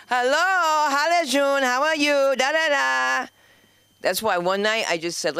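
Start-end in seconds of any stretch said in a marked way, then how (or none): 0.1-3.3 loud, high chatter into a phone; high, loud mock phone greeting; Persian phone caller. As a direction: mimic a guest yelling into a cellphone at a show. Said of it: "they come into the show".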